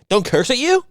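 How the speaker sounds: very high, rising mocking question; mock incredulous echo. Throwing the mover's complaint back at him, mock incredulous.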